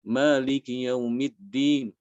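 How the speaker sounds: Maliki yaumiddin drawn out slowly, faint i at the end; slow recitation. Shown as a demonstration. Teacher reciting the verse as a demo. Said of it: "dibaca seperti ini".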